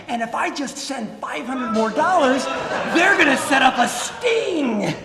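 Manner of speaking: mock the victim's growing excitement; imitating excited victim. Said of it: "He's like".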